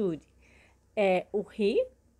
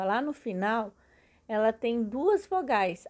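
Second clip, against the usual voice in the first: ordinary delivery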